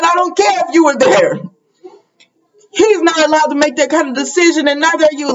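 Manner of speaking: high-pitched mock yell at Sally; retold yelling. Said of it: "started yelling at Sally".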